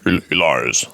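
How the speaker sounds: low, even robot voice. Pretend. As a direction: speak like a machine. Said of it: "I should have done that in the robot voice".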